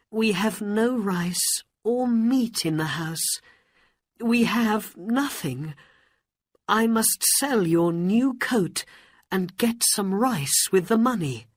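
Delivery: high, fretful acted voice; worried mother. Mother telling hungry son there is nothing, worried.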